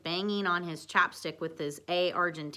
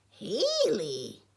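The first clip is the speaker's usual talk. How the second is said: croaked puppet voice, higher; Yoda croak; 4 semitones higher, 4 dB louder than usual. A narrator's reading. Yoda reacting to Haley's name, croaking and higher. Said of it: "croaked Yoda".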